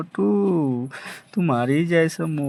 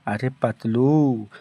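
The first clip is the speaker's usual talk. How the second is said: ordinary talk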